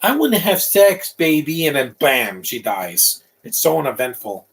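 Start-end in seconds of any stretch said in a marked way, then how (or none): 0.0-1.7 high-pitched put-on come-on; mock prostitute. Mocking a movie character's come-on, high put-on voice. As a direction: mimic the film's prostitute in a higher voice. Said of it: "That other prostitute is like".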